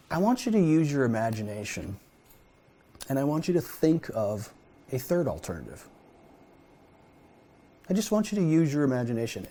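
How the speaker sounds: a hint of a tender divine voice; gentle voice of God. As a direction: coax him softly toward imagining. Voicing God gently to Moses.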